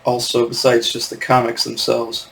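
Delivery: ordinary talk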